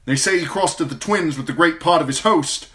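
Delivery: loud, high, hurried messenger knight; urgent knight's report. A narrator's reading. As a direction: report from horseback in a rush. Sir Adam reporting urgently.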